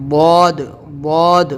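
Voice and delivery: loud, heavy "واد", broad tongue on the molars; full-mouthed, thick. Shown as a demonstration. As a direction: boom out a long, thick "واد". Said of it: "دونوں طرف کی داڑھ جو ہے اس میں ٹچ کریں گے".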